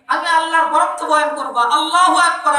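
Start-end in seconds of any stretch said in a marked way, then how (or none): 1.8-2.5 fervent proclamation. Real, not acted. Proclaiming God's greatness.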